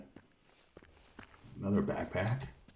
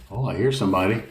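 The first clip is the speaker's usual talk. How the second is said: normal talking voice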